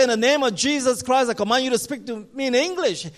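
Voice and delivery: loud, high exorcism command. Retold or imitated. A preacher commanding the demon to speak English, forceful. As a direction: order the spirit with full authority.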